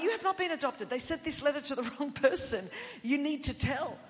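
high, loud, insistent denial; defensive. Retold or imitated. Sister denying her brother's adoption news, loudly defensive. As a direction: push back hard, acting out a defensive outburst. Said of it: "I got really defensive".

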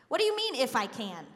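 high, loud, incredulous challenge; Jesus, sarcastic. Acted. Preacher voices Jesus answering the people, loud and sarcastic. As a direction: throw the question back at them sarcastically. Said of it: "Jesus gets a little bit sarcastic".

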